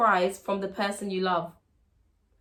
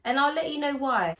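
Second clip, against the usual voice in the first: usual speaking voice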